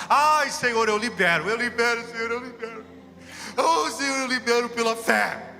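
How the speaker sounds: retold tearful prayer. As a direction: cry out to the Lord, breaking with tears. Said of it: "Quanto mais eu chorava". (oração chorosa e clamada em voz alta)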